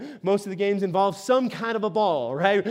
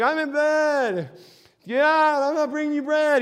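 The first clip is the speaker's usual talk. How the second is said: annoyed neighbor calling out; 5 semitones higher than usual. Retold or imitated. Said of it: "He calls out".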